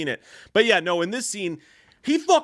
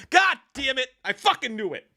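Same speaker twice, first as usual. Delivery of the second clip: high exasperated curse; mock exasperation; 8 semitones higher than usual. Man watching himself fall off a building, mock-exasperated cursing. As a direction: curse your luck in a raised, hammed-up voice. Acting out seeing his own doom.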